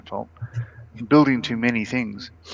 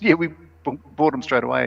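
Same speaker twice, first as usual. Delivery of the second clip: usual speaking voice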